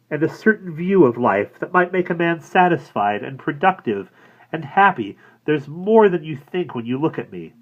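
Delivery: rising, high earnest listing; impassioned inner plea. A narrator's reading. Woman imagining pleading with police, earnest and high. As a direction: insist on her worth.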